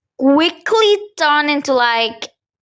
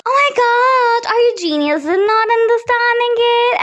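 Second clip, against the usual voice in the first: high-pitched, scornful disbelief; high mock scolding; exasperated teacher; 10 semitones higher than usual. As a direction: mimic a teacher losing patience with a student. Teacher scolds student for asking again.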